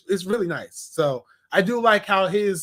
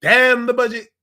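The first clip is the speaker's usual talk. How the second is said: mock defiant shout; 4 semitones higher, 8 dB louder than usual. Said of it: "Huey goes".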